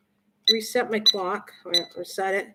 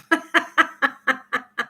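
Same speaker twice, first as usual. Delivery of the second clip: laugh; 4 semitones higher than usual. Real, not acted. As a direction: burst of amused laughter. Amused at viewers' comments.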